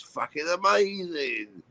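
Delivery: ordinary talk, nothing marked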